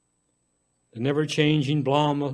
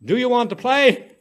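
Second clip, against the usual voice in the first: put-on high, louder child asking; eager child; 7 semitones higher, 6 dB louder than usual. A narrator's reading. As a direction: plead to play like a little girl. Child asks to play.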